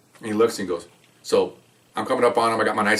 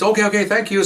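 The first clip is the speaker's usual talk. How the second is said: grateful thanks; 10 semitones higher than usual. Retold or imitated. Grateful robbery victim thanks police.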